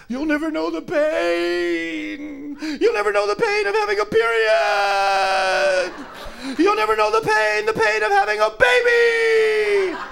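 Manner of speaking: high, loud, slow mock tirade; shrieking rant. The angry feminist ranting at the comic in a high, loud, slow voice. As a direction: hammer each pain like an outraged woman.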